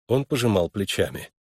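ordinary delivery, nothing marked

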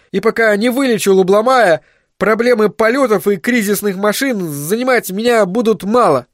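heated, high-pitched tirade; angry vow. Acted. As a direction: swear it with rising indignation. Angry outburst continues, vowing to fix it. (гневная, горячая клятва)

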